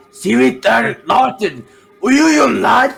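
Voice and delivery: high, loud yelling; loud shout. Real, not acted. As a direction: bellow at the team. Yelling at friends in game.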